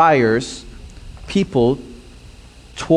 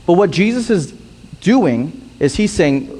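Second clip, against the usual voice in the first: ordinary talk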